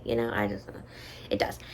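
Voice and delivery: whispered aside; conspiratorial whisper. Real, not acted. Whispering to viewers that it sounds sketchy. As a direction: lean in and whisper it.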